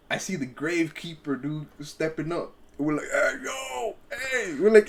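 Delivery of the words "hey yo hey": calling out. Retold or imitated. Calling out to gravekeeper.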